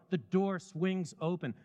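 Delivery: ordinary delivery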